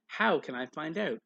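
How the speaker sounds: plain reading voice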